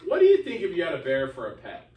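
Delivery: ordinary talk, nothing marked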